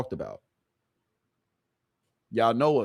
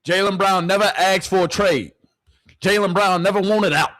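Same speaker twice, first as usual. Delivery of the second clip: loud and high, protesting, "never" pushed; indignant shout; 6 semitones higher, 5 dB louder than usual. Real, not acted. Streamer answering the news clip, indignant shout. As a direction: yell it back at the screen.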